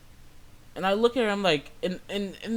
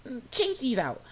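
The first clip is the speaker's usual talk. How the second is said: higher-pitched imitation of a pushy customer; demanding Karen; 8 semitones higher than usual. Customer ordering the bagger to redo it, demanding. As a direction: mimic an impatient customer, voice pitched up. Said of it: "She's just like".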